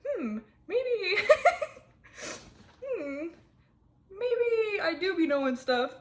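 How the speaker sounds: laughing self-quote. Retold or imitated. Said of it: "I'm like".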